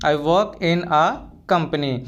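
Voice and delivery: slow, carefully spelled-out English phrase; slow and clear classroom model phrase; slow teaching demo. Shown as a demonstration. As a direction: say each word slowly for the learner. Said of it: "तो आप ऐसे बोल सकते हो".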